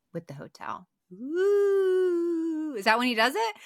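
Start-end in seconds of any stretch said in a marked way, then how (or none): 1.1-3.6 high, loud sudden realization; light up with a sudden discovery; excited ooh. Real, not acted. Co-host realizing the hotel plot twist, excited.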